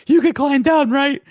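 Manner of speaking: loud, high imitated question; mock urging. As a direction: push it high and loud, mimicking her. Acts out woman urging the snail.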